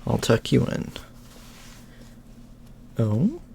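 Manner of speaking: usual speaking voice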